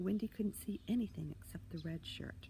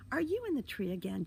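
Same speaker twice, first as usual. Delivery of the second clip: cross, louder, higher scolding; exasperated raised voice; loud huff; 5 semitones higher, 6 dB louder than usual. A narrator's reading. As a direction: huff it at a naughty little brother. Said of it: "huffed loudly".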